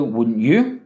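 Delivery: WOULDN'T YOU rising sharply at the end; low start, swooping up on YOU; question tag. Shown as a demonstration. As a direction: lift YOU upward as a question. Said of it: "go up again with an accent".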